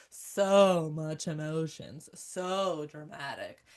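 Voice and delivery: low, very slow mimicking sneer; exaggerated, drawn-out mockery; mock disdain. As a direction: drag it out like a scornful critic. Mimics critics of emotional women.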